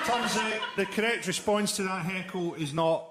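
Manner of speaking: normal talking voice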